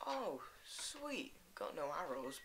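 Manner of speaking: usual speaking voice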